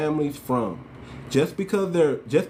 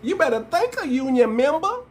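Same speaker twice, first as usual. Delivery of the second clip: high-pitched put-on voice, rising at the end; high mimicry of a politician's put-on talk; mocking Kamala; 10 semitones higher than usual. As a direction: exaggerate a fake folksy speaking style, pitched way up. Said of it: "We don't even talk like that".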